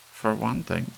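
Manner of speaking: lightly voiced elderly complainer; old lady fussing. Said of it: "she said aloud to no one".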